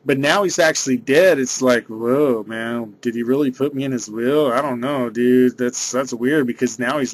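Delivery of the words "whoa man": ordinary talk, nothing marked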